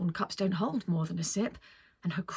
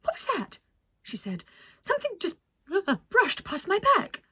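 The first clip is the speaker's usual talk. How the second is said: much higher, startled squeak; squeaky startled Penelope, louder by the end; surprised and squeaky; 11 semitones higher, 5 dB louder than usual. Acted. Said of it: "in a surprised and squeaky voice".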